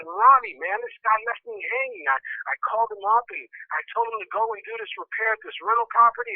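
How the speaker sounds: high, agitated venting; really upset. Retold or imitated. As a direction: rant to a friend in hurt disbelief. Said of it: "[name], you're really upset".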